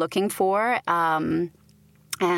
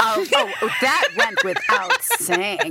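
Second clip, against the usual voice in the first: laughing; 9 semitones higher, slower than usual. Real, not acted. Teasing host's favorite question.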